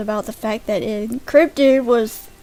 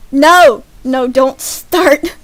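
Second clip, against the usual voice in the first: loud, higher warning; playfully forceful telling-off; mock scolding; 3 semitones higher, 14 dB louder, slower than usual. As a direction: cut them off with a big mock-stern no. Mock-scolding viewers over a joke.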